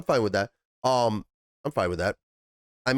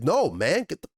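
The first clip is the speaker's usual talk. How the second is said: mock irritated refusal; 7 semitones higher, 3 dB louder than usual. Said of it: "she's like".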